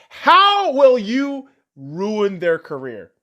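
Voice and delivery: slow mocking repeat. Real, not acted. Repeats the question, drawn out.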